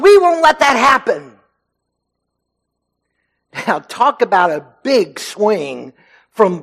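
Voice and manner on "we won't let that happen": loud, high, urgent cry of refusal; shouted protest. Retold or imitated. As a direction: shout it like a man who won't allow it. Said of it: "Peter shouted out".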